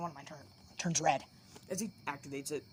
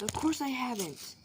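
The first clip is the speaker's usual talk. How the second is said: loud, higher-pitched indignant yell; raised-voice outburst as Kaneki; yelled retort; 6 semitones higher, 5 dB louder than usual. A narrator's reading. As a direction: yell it back in protest. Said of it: "Kaneki yells".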